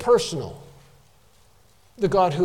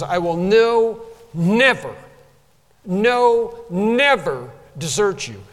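"no" and "never" louder, set apart by pauses; emphatic no, never; 3 semitones higher, 4 dB louder, slower than usual. Shown as a demonstration. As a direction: hammer out "no... never" one beat at a time. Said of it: "the way in which that's stated in the original is".

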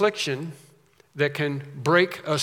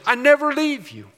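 high God promise; 10 semitones higher, 5 dB louder than usual. Acted. God's promise voiced.

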